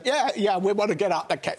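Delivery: retold, half-laughing, a light Scottish lilt; slipped Scottish accent. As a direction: quote yourself sounding Scottish without meaning to. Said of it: "There, you've just done it".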